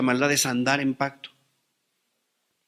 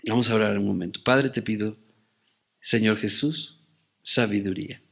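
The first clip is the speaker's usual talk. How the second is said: low, hushed prayer voice; 4 semitones lower, 5 dB quieter than usual. Real, not acted. Pastor leading the congregation in asking for wisdom, hushed prayer. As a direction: pray softly and low, pausing between phrases.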